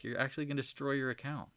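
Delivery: usual speaking voice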